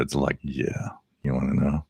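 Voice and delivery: mock-ominous ghost story voice, hushed; big spooky voice. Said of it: "I got the big voice for it".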